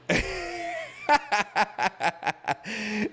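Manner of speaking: real burst of high laughter; big laugh. Real, not acted. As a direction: streamer cracking up at a viewer's comment. Laughing at chat joke.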